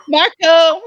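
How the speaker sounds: high, loud game call; playful calling out. Pretend. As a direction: sing out for a lost friend. Calls out the pool game.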